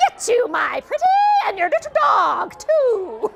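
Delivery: a hint of the witch's threat; wicked witch voice. Shown as a demonstration. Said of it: "You want my famous line?".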